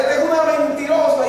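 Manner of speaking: a son lashing out at his mother; angry accusation. Retold or imitated. Hijo discute con la madre. (hijo furioso, gritando a su madre)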